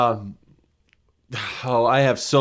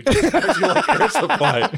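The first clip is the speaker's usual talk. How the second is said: laughing; 10 semitones higher, slower than usual. Real, not acted. Mocking friend's music taste.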